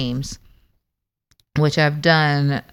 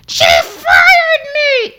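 very high squeaky wail with a laughing sob; [name]; 7 dB louder, much slower than usual. Acted. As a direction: wail it in a shrieking voice. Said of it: "Hi, I'm [name]".

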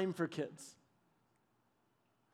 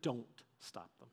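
low stern dad voice; 8 semitones lower, 13 dB quieter than usual. Retold or imitated. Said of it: "that dad look".

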